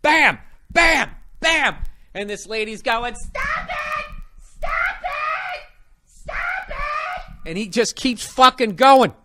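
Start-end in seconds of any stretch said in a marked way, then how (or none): 0.0-1.8 pipe hit noises. Retold or imitated. Imitating pipe hits. 3.2-7.5 shrill falsetto mock scream of stop it; hammed-up high-pitched screaming lady; screaming woman. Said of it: "this bitch is screaming".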